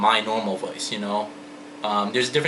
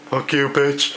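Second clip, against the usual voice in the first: Two-Face voice; 3 semitones higher, slower than usual. Retold or imitated. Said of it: "if I was Two-Face".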